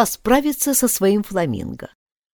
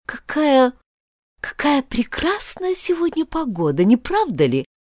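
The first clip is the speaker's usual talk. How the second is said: timid; 7 semitones higher than usual. A narrator's reading. Nervous rabbit making small talk, timid and high. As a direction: falter shyly, pitched high. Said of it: "робко произнёс".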